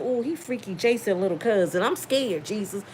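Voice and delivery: mock scared. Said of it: "I said".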